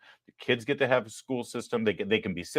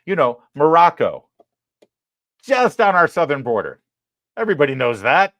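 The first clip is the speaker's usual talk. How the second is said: loud, higher mock certainty; sarcastic; 6 semitones higher, 8 dB louder than usual. Real, not acted. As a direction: play it like it's obvious. Mocking geography claim.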